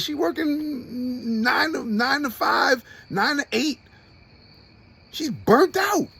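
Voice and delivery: high, strained, close to tears; voice cracking. Real, not acted. Describing his overworked mother, voice cracking with emotion. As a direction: let the voice break as it climbs.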